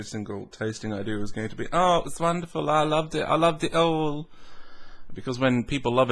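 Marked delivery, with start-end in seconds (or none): 1.7-4.3 mock gushing. Mocking reviewers who love every whiskey, in gushing voice.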